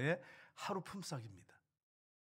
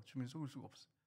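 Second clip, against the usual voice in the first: much softer, dropped low; hushed repeat; 3 semitones lower, 11 dB quieter, faster than usual. Real, not acted. Pastor repeating a warning to the congregation, hushed. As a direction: let the warning sink in quietly.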